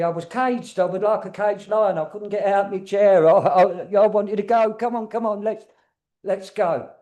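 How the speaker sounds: a hint of a frustrated old lady desperate to move; restless spirit. Medium voicing the chair-bound spirit eager to get going. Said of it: "she's saying to me".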